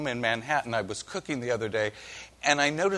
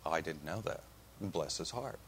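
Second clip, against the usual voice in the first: soft, low murmur of sympathy; hushed condolence, acted as an example; sympathetic; 8 semitones lower, 13 dB quieter than usual. Shown as a demonstration. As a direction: respond gently to sad news, voice dropped. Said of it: "One is sympathy".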